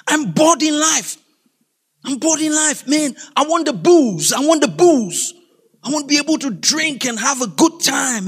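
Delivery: high, insistent complaint; dramatic, high-pitched griping; discontented skit character. Acted. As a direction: demand it like someone fed up with life. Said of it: "That's the second person".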